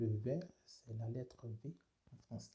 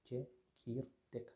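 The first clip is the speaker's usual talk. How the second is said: slow repeat; much slower than usual. Shown as a demonstration. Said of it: "On a le mot".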